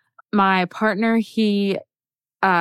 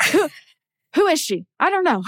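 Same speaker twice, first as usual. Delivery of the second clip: high-pitched mock confusion, in fragments; retold bewilderment; 8 semitones higher than usual. Friend mimicking her dazed proposal reaction, mock confusion. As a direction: act out being too stunned to think. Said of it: "apparently my reaction of".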